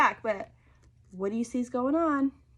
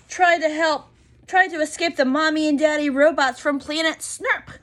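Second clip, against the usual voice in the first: louder, higher adventurous read; dramatic excitement; 4 semitones higher, 7 dB louder than usual. A narrator's reading. Reading the robot escape to children, louder and dramatic. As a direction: build it like a chase.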